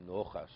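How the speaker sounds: Nachas with a rounded "o" in the first syllable; Russian komats pronunciation. Shown as a demonstration. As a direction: stretch the komats in Nachas into "Noh-khas". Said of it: "you really pronounce the komats".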